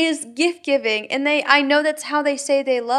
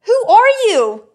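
louder and higher, incredulous; outraged disbelief; 5 semitones higher, 5 dB louder than usual. Real, not acted. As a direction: demand it as if he's from another planet. Outraged at the husband's gift comment.

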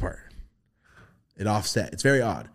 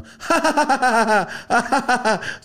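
high, loud mock cackle; grandma's laugh; 8 dB louder than usual. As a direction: burst out in a shrill cackle. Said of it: "just starts laughing".

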